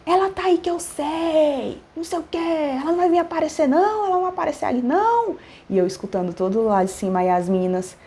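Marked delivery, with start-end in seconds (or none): 0.1-5.4 high-pitched mock tirade; imitated cursing. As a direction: mimic an angry customer, speeding up into a high rant. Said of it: "Me xingando lá".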